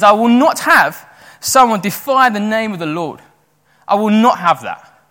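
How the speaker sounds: loud, emphatic retold refusal; firm defiance. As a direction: put your foot down, hitting each word hard. Acting David's defiant refusal.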